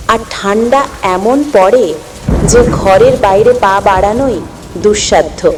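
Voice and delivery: usual speaking voice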